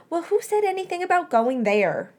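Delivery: demanding. A narrator's reading. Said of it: "Remus demanded".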